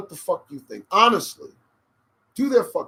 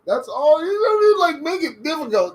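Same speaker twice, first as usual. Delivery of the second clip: exasperated outburst; 12 semitones higher, 8 dB louder than usual. Real, not acted. Exasperated exclaiming.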